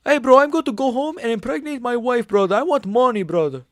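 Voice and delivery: high fighter impression, full tilt; Moicano voice. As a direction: play Moicano hyped up. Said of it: "a decent Moicano".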